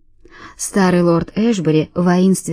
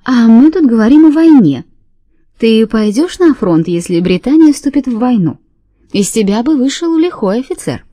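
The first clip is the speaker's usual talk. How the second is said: higher, louder, rattling little voice; rattly little voice; 3 semitones higher, 4 dB louder than usual. Acted. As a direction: rattle it out in a tinny voice. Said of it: "дребезжащий голосок Фенни".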